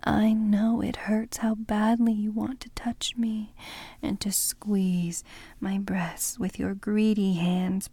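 ordinary talk

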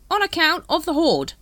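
high, loud cockney-ish hawker; street hawker voice. Acted. Dibbler greets the Captain, high hawker voice. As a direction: sidle up like a spiv.

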